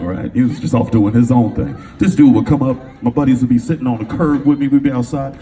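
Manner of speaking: ordinary delivery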